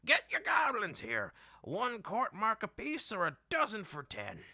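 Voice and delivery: put-on high, loud old market woman hawking; old lady hollering. A narrator's reading. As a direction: cry the wares from atop the wagon. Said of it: "an old lady hollered".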